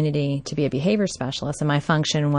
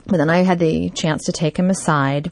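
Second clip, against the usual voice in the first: normal talking voice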